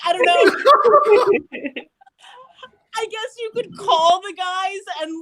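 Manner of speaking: high-pitched reply broken by laughter; laughing high. Real, not acted. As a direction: laugh through the words, voice way up.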